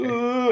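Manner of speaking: high-pitched mock "uh" whine; imitated child's whimper. Dad imitates his son showing the bitten tongue, mock whimper. Imitating the hurt son's noise.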